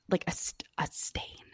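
hushed, voiceless confiding; discreet whisper. Retold or imitated. Stranger discreetly warns her about the skirt, in a whisper. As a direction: whisper it as an embarrassing secret, faltering.

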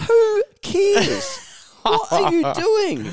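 very high, loud incredulous yell; exasperated outburst. Retold or imitated. Mocking news addicts with a very high, loud disbelieving yell. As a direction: shriek it in mock disbelief. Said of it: "I'm like".

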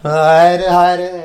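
loud hammed-up announcer calling out the stop. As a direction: call out the stop like a rally driver. Mock racing-church driver announcing.

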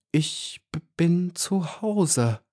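high, slow, faint-hearted stammer. A narrator's reading. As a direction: stumble on the first sound, drawing it out. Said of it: "stammelte sie mit blassen Lippen". (stammelnd, hoch und gedehnt)

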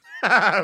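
short, high burst of laughter; loud laugh. Real, not acted. Comic laughing at an email's curt greeting, loud laugh. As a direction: crack up loudly and high.

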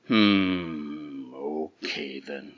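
slow, grudging troll. Acted. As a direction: rumble it slowly, giving in. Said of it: "said the troll".

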